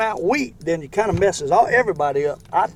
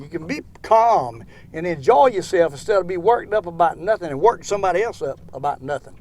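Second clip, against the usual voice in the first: normal talking voice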